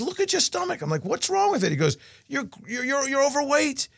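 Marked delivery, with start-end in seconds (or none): 0.0-0.8 doctor's voice. Retold or imitated. Doctor voiced, much higher. 1.0-1.7 high, offended what's-wrong; hammed-up indignation, much higher; indignant disbelief. Speaker's indignant retort retold. 2.3-3.9 stammering doctor. Retold or imitated.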